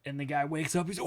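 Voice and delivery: usual speaking voice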